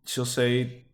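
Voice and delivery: ordinary talk, nothing marked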